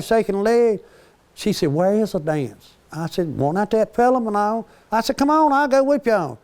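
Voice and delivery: flirty teasing. Retold or imitated.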